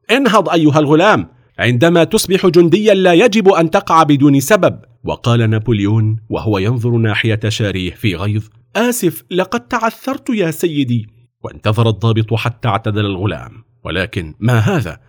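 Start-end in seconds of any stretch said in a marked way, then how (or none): 0.1-4.8 raised, louder stern order. A narrator's reading. Officer orders the fallen boy up, sternly. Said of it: "في صوت صارم". 8.8-11.1 put-on higher-pitched young recruit; lightly voiced apologetic boy. A narrator's reading. Voices young Napoleon apologising to officer.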